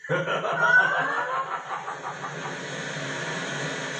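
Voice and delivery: high villain cackle; evil cackle. Acted. Villain gloats before eating.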